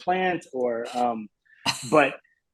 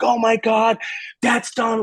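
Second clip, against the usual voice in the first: out-of-her-mind excited; 9 semitones higher than usual. Retold or imitated. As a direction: imitate a thrilled fan mom, pitched way up. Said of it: "she was just out of her mind excited".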